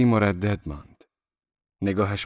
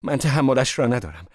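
high, raised, pleading cry; desperate cry; 6 semitones higher, 5 dB louder than usual. A narrator's reading. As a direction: cry out and beg, pitched up. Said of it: "دیزی فریاد کشید". (فریاد ملتمسانه با صدای زیر)

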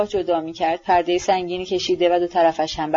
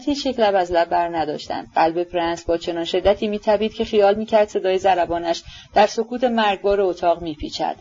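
usual speaking voice

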